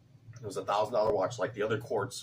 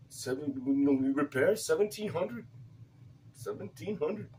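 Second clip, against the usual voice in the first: higher, light mock Indian accent; bad Indian accent; 6 semitones higher than usual. Repairman quoting the watch value, bad Indian accent. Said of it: "Indian bad accent".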